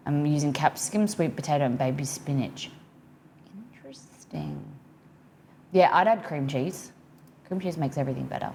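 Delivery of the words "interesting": Interesting breathed as a soft fading whisper. Real, not acted. Streamer muses on chat's veggie dip in a whisper. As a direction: hush Interesting almost to nothing, falling off at the end. Musing again, hushed.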